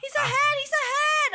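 high-pitched worried squeal; alarmed. Pretend. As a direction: cry out that a rival passes you. Player losing the race.

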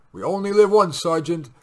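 high loud heroic line; bold loud bravado. A narrator's reading. Captain to sergeant before the mission, bold bravado. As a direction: toss it off fearlessly.

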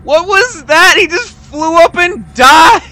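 high, squealing disbelief breaking into laughter; hysterical laughter. Real, not acted. Player cracking up at a monster's absurd death, hysterical laughter. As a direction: crack up helplessly, real loud high-pitched hysterics. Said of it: "still giggling from just seeing him".

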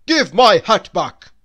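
loud, furious bellow; thundering. A narrator's reading. Angry man bellowing at Mister Good over his hat. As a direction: roar it at the stranger. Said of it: "he thundered".

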